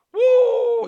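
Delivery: very loud, high "woo!"; exultant whoop. Acted. Believer overflowing with thanks to the Lord, exultant whoop. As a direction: let out a soaring whoop of joy. Overflowing while acting out gratitude.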